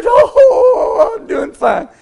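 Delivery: high, loud wail on the opening Oh; put-on tearful howl, settling as it goes; imitated crying. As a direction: wail it like a weeping sick man. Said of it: "He started crying".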